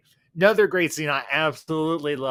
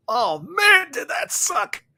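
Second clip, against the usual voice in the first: high-pitched, strained exclamation; hammed-up groan of complaint after a hard fall; acted pained complaint; 11 semitones higher, 5 dB louder, slower than usual. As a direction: start loud and high, then let it thin out. Acts out Rufus after his fall.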